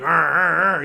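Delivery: drawn-out mock growling; rolling growl noise; imitated dog growl. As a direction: growl like dogs squaring off.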